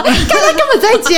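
amused disbelief while laughing. Real, not acted. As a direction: laugh out the punchline. Laughing at the absurd landlady.

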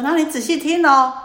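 put-on an old monk hushing a listener loudly; loud call to listen. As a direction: call out so the whole market hears. Monk calls merchant to listen.

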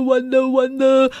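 ordinary delivery, nothing marked

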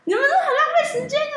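mock coworker. Coworker teasing them for wasting time, high put-on voice.

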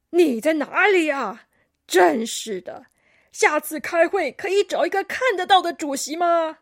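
put-on loud, exasperated old koala; cranky grandpa complaint; huffy. A narrator's reading. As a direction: grumble loudly at someone you can't find. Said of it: "他气呼呼地".

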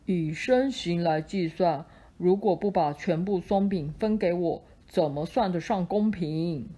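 low, heavy elephant voice; big elephant. Acted. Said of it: "大象说".

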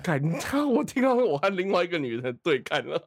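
ordinary talk

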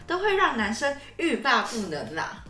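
ordinary talk

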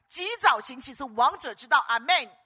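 booming preacher's shout, stress on 早; rally the congregation with 及早行起; 早 driven hard and loud, held long; proclaimed motto. Real, not acted.

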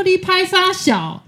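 slow, loud and high mock curse; defiant retort. Girlfriend snapping back at the bossy coach, loud and slow. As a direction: spit each syllable out slowly and loudly. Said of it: "我想说".

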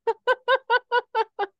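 high burst of laughter; real loud laugh. Real, not acted. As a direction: laugh hard at the punchline. Laughs at own story punchline.

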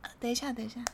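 very soft timid mumble; barely audible. Retold or imitated. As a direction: mumble it so no one hears. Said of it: "你学一下". (怯生生的极小声)

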